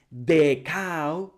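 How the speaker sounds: "the cow" with "the" as a long, full deh; wrong "deh". Shown as a demonstration. Teacher shows another mistake, slow deh cow. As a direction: stretch "the" into deh before "cow". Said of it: "no es the cow".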